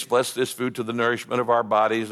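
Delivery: low monotone cliché grace; rote recitation. Acted. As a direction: recite a stock mealtime prayer without thinking. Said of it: "all of our little cliches".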